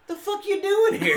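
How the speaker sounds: high, loud mock-incredulous question through a laugh; incredulous challenge. Host confronting friend drinking on the porch, laughing mock disbelief. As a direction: act out catching her there, voice shooting up.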